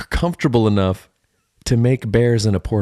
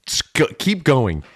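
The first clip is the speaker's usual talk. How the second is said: ordinary talk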